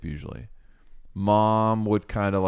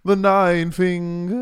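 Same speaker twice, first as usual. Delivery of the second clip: grand, higher epic title; epic narrator; 8 semitones higher, 6 dB louder, slower than usual. Pretend. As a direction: announce it like a legend. Grand epithet for Frodo.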